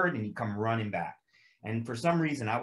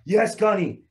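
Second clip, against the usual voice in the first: recruits answering; 10 semitones higher, 9 dB louder, slower than usual. Retold or imitated. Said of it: "they're like".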